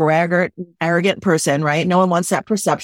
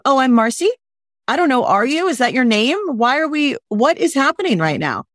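every line ends rising like a question, higher; mock a speaker unsure of her own name; uptalk; 5 semitones higher than usual. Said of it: "they're like".

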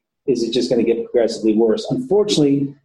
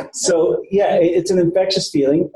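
normal talking voice

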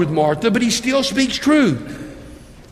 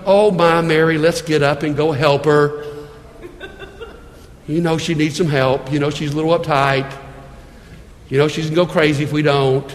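sweet Jesus; 5 semitones lower than usual. Pretend. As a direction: coo it gently to Mary. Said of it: "doing the sweet thing and going".

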